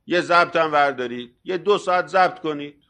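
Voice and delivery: ordinary delivery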